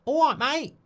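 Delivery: high-pitched cartoonish Essex accent. Shown as a demonstration. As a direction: greet a pal in a squeaky Essex accent. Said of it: "Mark, do an Essex accent, please".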